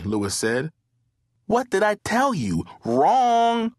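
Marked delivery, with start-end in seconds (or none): 1.5-3.7 high-pitched, loud contradiction; scolding correction. A narrator's reading. Brandon argues over the Friday movies, scolding correction. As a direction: pitch it up and belt out "wrong".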